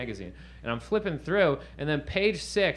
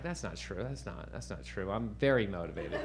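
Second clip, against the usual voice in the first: a soft, low, sheepish denial; defensive mumble; 5 semitones lower, 7 dB quieter than usual. Retold or imitated. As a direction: mutter it, half-convinced yourself.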